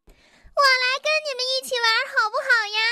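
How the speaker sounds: very high, fast squeaky stranger. Acted. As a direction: pipe up suddenly in a shrill little voice. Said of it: "尖声尖气的声音".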